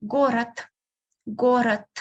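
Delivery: город ending in a voiceless t; final D as T. Shown as a demonstration. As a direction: close город on a dull t. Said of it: "you can hear me pronouncing T instead of D".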